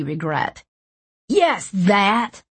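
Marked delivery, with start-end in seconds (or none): 1.3-2.4 angry. A narrator's reading. Angry reply.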